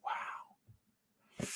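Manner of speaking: usual speaking voice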